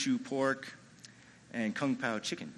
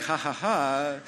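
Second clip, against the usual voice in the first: imitated laugh; slower than usual. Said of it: "waiting for him to be like".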